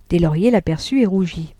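ordinary delivery

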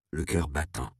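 usual speaking voice

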